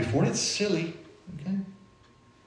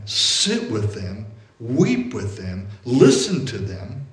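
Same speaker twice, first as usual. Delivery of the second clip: tender, slow; 4 semitones lower, much slower than usual. Real, not acted. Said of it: "slow tender instruction".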